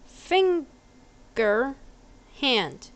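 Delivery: "Finger" drawn out in two split syllables, rising, then "hand" falling; patient, over-clear demonstration; slow emphatic repeat. Shown as a demonstration. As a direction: spell it out slowly, syllable by syllable.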